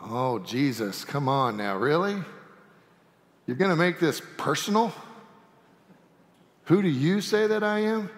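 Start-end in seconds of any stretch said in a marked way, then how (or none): none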